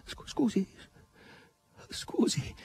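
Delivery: soft, high, stammered apology; subdued murmur. A narrator's reading. Margherita apologizes to Asasello, murmuring and subdued. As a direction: fade into a whisper, cowed. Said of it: "mormorò Margherita, ormai soggiogata".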